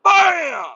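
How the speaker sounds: loud, high "bam!" crash noise; crash sound. Retold or imitated. Retelling the crash to listeners, loud "bam!" crash noise. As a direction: blurt out the impact. Truck hit from behind.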